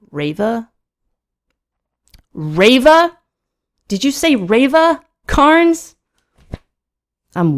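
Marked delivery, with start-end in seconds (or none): 0.1-6.0 rising disbelief, building higher and louder; escalating from puzzled to loud, high astonishment; incredulous. Acted. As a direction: echo it back as a stunned question. Narrator disbelieving what Carnes said.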